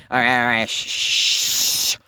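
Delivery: ordinary talk, nothing marked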